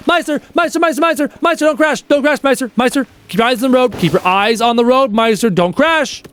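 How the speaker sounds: high, rapid-fire pleading, name repeated; mock urgent warning. Streamer warns a viewer driving home not to crash, mock urgent. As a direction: nag a driver in mock panic.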